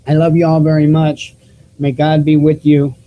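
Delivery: ordinary talk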